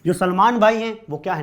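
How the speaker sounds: ordinary delivery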